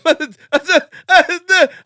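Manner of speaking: a sudden high, loud wail, half laughing; mock blubbering wail, way up high; high wailing sob-laugh. Retelling how he and his roommate bawled together, a hammed-up high wail. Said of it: "We're crying our eyes out".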